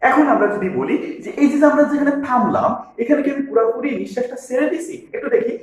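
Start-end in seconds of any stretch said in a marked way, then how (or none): none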